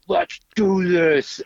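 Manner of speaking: high, slow, stretched rally cry; excited cheer. Retold or imitated. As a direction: fire the whole shop up. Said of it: "Everybody in the shop was excited".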